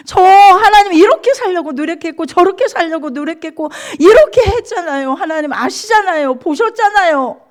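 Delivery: high, impassioned re-enacted prayer; crying out resentfully. Believer demanding to know their fault from God, crying out. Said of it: "소리소리 지르고 하나님 앞에 원망하고 울고".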